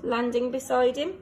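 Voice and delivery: ordinary delivery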